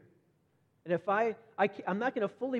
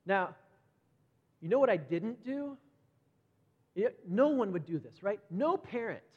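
normal talking voice

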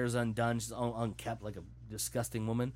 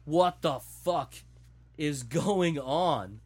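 slow, loud, weighty exclamation; retelling a stunned reaction, slow and emphatic; retold disbelief; 3 semitones higher, 4 dB louder, much slower than usual. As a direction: draw out each word in loud disbelief. Retold shocked reaction to film.

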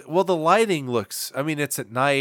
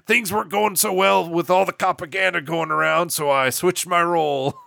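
cartoon news-hound voice, pitched up; McGruff; 5 semitones higher than usual. Co-host bringing in the news segment as a mascot, put-on dog voice. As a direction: play a hammed-up talking dog mascot. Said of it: "It's me, McGruff, the, the news hound".

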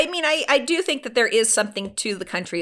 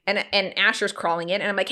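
normal talking voice

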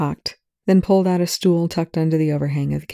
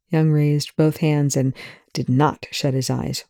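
usual speaking voice

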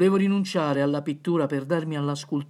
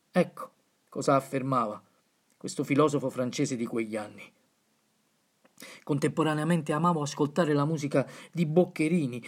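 ordinary delivery

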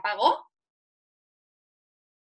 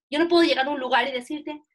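usual speaking voice

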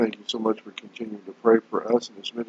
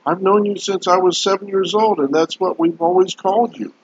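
astonished; 8 semitones higher than usual. Retold or imitated. Friend astonished at the news.

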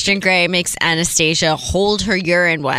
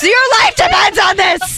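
higher, louder desperate plea; urgent shout; 11 semitones higher, 5 dB louder than usual. Acted. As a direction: shout it like a life depends on it. Persuasion game escalation.

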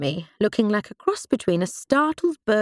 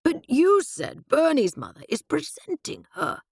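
objecting; 8 semitones higher than usual. A narrator's reading. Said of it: "objected my father".

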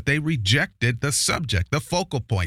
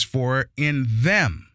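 slow, leaning hard on "in them"; stress on in them; much slower than usual. Shown as a demonstration. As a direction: draw out "for in them" and weigh each word. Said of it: "that is the accent point, that is the emphasis".

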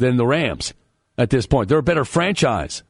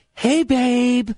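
much higher, goofy pet-name voice; goofy babe voice; 10 semitones higher than usual. Retold or imitated. Imitating Dave answering his wife's call, goofy high voice. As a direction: pitch it way up like a smitten husband. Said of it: "in that voice".

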